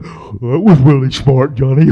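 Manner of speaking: low, loud, slow mocking imitation; Gary. Friend jeers from the bottom of the hill, mocking. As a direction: drag it out like a dopey heckler. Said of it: "Gary, standing at the bottom of the hill, he went".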